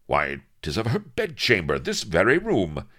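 lightly voiced old-time captain with loud bursts; a hint of stagey seafaring captain; excited pirate captain. A narrator's reading. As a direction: announce the find with archaic relish. Voices Avery reading chart.

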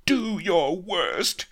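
high, slow, defiant heroine; indomitable cry. A narrator's reading. Captive Vanity defies the villain, crying out. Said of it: "cried the indomitable Vanity".

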